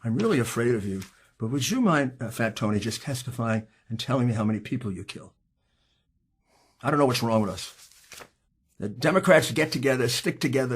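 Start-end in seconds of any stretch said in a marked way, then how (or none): 0.0-5.3 softer, sarcastic fake-fearful request; mock timid. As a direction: pretend to be scared, with irony. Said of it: "I went up to them and I said".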